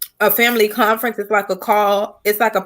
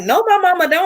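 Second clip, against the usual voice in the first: mock denial; 10 semitones higher than usual. Mom denying she has a YouTube, high and mocking. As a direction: play it up high like denying it to her daughter. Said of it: "And I was like".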